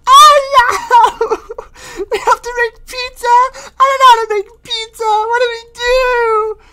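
very high, crying cartoon lady; panicking Screen Lady. Screen Lady panics about making pizza, high crying wail.

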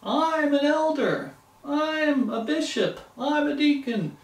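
high, slow, wounded pride; puffed-up offended elder; indignant rant. Pretend. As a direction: demand respect for your title. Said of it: "And start name-calling".

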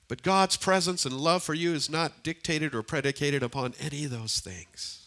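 ordinary talk

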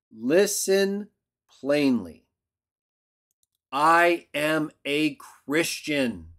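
slowed, weighty words; solemn slow confession of faith; slow solemn declaration. Acted. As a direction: let each word land slowly. Slow solemn climax of quote.

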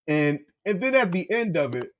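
ordinary delivery

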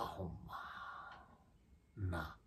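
soft, slow under-the-breath mutter; a slow, barely voiced grumble. Real, not acted. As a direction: mutter it to yourself in disgust. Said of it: "腹立つわ".

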